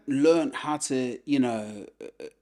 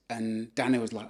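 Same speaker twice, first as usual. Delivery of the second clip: ordinary delivery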